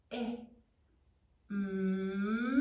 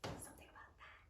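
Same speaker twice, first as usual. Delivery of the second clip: soft whispered hint; whispered aside to the class; 25 dB quieter, much faster than usual. Real, not acted. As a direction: breathe it as a secret hint.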